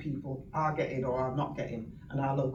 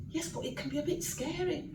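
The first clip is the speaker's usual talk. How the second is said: minister's quote; 11 semitones higher, 6 dB quieter than usual. Retold or imitated. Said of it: "And he went".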